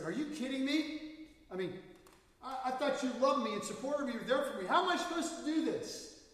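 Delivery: high, loud upset outburst; angry wife. Retold or imitated. Preacher voices the blind wife angry at her husband. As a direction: cry out as the betrayed wife. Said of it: "she got angry and said".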